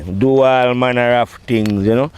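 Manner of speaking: ordinary delivery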